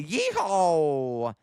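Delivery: long drawn-out mock yeehaw; cowboy yeehaw. Streamer gives a viewer what they want, mock cowboy yeehaw. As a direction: stretch out a playful cowboy whoop.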